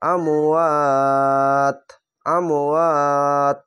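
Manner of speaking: drawn-out chanted reading of তাকুলু, vowels held long; slow Quran recitation. Shown as a demonstration. Teacher demonstrating tajweed to students, slow chanted recitation. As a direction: recite তাকুলু slowly, stretching each vowel. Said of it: "এভাবে থেমে যাবেন".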